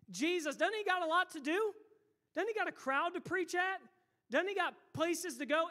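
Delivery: high, loud protesting questions; indignant disciples. Acted. Preacher voices disciples protecting Jesus's time, indignant. Acted disciples scolding the beggar.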